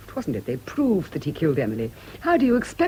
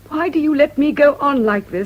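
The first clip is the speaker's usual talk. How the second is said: higher and louder, close to shouting; agitated outburst; 8 semitones higher, 7 dB louder than usual. Acted. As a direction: let it burst out of her. Patient losing control, outburst.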